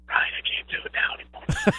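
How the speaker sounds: dad's voice worn out, barely a whisper; hoarse whisper. Retold or imitated. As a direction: rasp it out like a coach who has lost his voice. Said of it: "I'm just all raspy voice".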